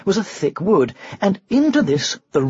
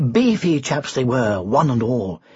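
ordinary delivery, nothing marked

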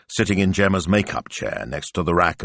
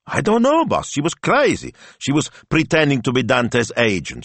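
flustered protest; 8 semitones higher than usual. Acted. Smilzo explaining a rude caller to his boss, flustered. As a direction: protest high and worked up.

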